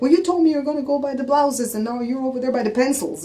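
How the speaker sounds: a hint of a fussy husband; imitating husband complaining. Wife mimicking husband's complaint at Walmart. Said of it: "He's like".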